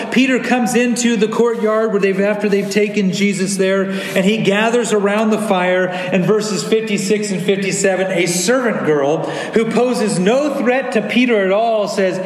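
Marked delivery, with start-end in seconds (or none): none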